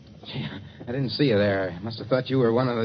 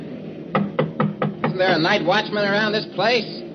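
calling out; 10 semitones higher, much slower than usual. Acted. Calling through glass doors at night.

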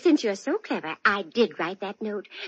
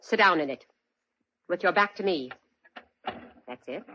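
cold menace; 3 semitones higher than usual. Acted. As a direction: drop the silly act and threaten him coldly. Drops the act, holds him at gunpoint.

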